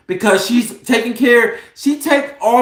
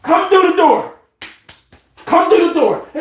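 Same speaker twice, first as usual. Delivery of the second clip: high-pitched call toward the door; 8 semitones higher than usual. Real, not acted. Calling son through the door.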